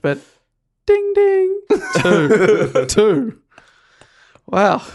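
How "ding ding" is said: correct answer ding. Pretend. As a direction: double high ding chime. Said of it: "I didn't think I had this sound effect".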